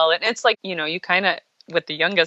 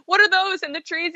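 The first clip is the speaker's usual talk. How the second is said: dad quizzing son; 10 semitones higher than usual. Retold or imitated. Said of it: "my husband goes".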